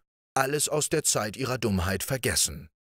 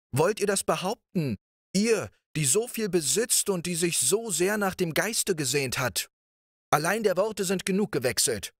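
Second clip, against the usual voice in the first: much higher prince voice, eager and persuasive; pleading prince; 10 semitones higher than usual. A narrator's reading. Riquet presses the princess to accept him, high and eager.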